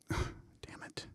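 hushed, breathy damn it; whispered curse. Retold or imitated. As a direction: whisper it under your breath. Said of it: "And he's like".

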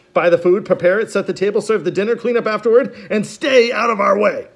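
high, loud villain voice; Count Olaf's note. A narrator's reading. Olaf's note ordering the orphans, high loud villain voice. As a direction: bark the note out as Olaf. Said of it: "his note read".

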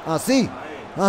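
usual speaking voice

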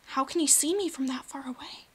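unvoiced hushed whisper. Real, not acted. Gamer hiding from sea serpent, whispering. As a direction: whisper so the serpent won't hear.

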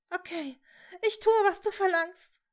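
high, tearful surrender; sobbing. A narrator's reading. Hermine yields to Voldemort, sobbing. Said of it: "schluchzte sie".

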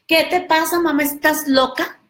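put-on high indignant kid; rude daughter. Daughter talks back to her mother, high indignant voice. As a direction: sass your mother.

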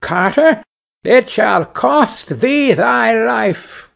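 high, slow, otherworldly bird voice; taunting sparrow. A narrator's reading. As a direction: drawl the threat like a vengeful little bird. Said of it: "and cried".

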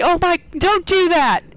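playful mock scolding, pitched high and loud; high, loud pretend outrage; mock shout. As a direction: shout it like a hammed-up telling-off. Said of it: "you may have to shout at me".

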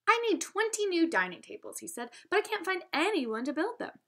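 a touch of a restaurant owner asking for help; lightly voiced customer. Mister Williston voiced in story.

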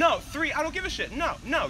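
high, heated refusal; indignant arguing. Acted. Refusing a friend's excuse mid-list, high and indignant. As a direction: talk over the excuse, pitch jumping up.

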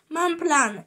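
ordinary delivery, nothing marked

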